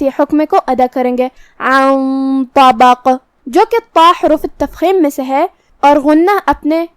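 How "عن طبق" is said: عن طبق with nasal nun hidden into heavy ta, slow; hidden nun with ghunna. Shown as a demonstration. Teacher showing ikhfa, slow nasal demo. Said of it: "نون ساکن چھپائیں گے اور غنہ کے ساتھ".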